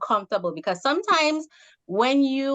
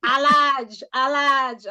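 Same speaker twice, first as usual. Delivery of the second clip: imitating husband, louder and higher; husband ordering; 5 semitones higher, 4 dB louder, much slower than usual. Wife mimicking husband at the drive-thru, insistent. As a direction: repeat the order insistently in his accent. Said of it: "he would just go to the drive-thru".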